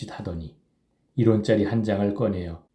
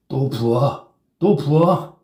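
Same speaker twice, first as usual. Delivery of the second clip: calling out louder and higher; shouting; 6 semitones higher, 6 dB louder, much slower than usual. A narrator's reading. Drunk Kim demanding another drink, loud shout. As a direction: bark the order for more. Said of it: "라고 외쳤다".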